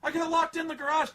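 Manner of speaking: high, loud mock girl voice; panicked girl. Daughter telling dad about garage, high loud mock panic. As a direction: blurt it like a frightened teen. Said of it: "she's like".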